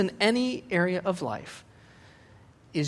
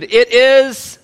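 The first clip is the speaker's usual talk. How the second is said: ordinary talk, nothing marked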